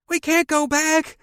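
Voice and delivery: high, loud panicked scream. A narrator's reading. Girl seeing the arch ahead, panicked scream. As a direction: scream it at the driver. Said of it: "Audrey screamed".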